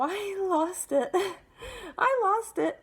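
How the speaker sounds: high, loud, laughing through the words; drawn-out words breaking into a laugh; laughing admission. Real, not acted. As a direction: confess it with a helpless laugh. Laughs recalling her outburst.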